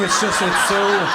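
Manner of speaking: high, loud, slow, laughing; laughing sarcasm. Real, not acted. Comic mocking Fitbit, laughing high. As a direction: laugh through the sarcastic praise.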